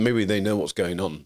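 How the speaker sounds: ordinary talk, nothing marked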